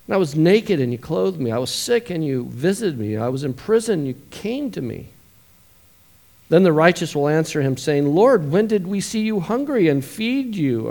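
rising preacher's read, building to loud high questions; preaching scripture. Acted. Preacher reading Matthew twenty-five to the congregation, building to full force. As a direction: proclaim the verses, then cry out the questions.

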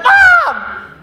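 very high, loud shriek for Mom; scream. Retold or imitated. Sister screaming for her mother during a wet willy, very high and loud. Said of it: "She screamed".

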